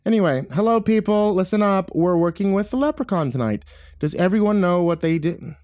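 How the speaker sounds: high, projected announcement; rally the room briskly, pitched well up; calling out. Acted. Assistant director briefing the film crew, calling out.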